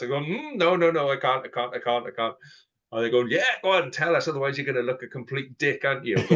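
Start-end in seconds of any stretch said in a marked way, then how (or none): 0.2-2.3 coy teasing refusal. Retold or imitated. 3.2-6.2 mock goading fans. Fans goading him to tell.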